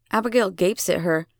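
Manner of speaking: usual speaking voice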